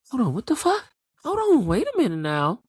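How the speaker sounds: high, incredulous double take; stunned disbelief. Retold or imitated. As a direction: act out a shocked viewer, voice shooting up. Voices stunned viewer's reaction.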